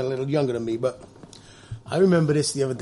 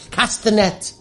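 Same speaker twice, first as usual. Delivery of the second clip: preacher voice; 7 semitones higher, much slower than usual. Pretend. Preacher voice quoting Jesus.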